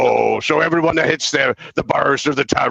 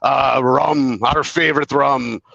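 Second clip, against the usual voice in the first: ordinary talk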